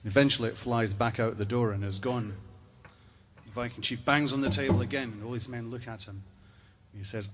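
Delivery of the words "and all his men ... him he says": ordinary talk, nothing marked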